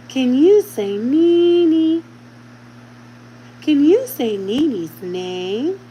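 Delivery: high, very slow sing-song teaching voice; Miss Rachel. Shown as a demonstration. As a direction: coax a toddler to repeat, stretching each word upward. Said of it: "talking in the Miss Rachel voice".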